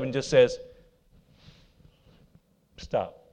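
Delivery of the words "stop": low, soft, calm stop; calm quiet stop. Shown as a demonstration. Showing the church calm authority, soft low command. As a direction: say it softly without raising your voice. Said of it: "Don't raise their voice".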